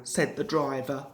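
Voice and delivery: ordinary delivery, nothing marked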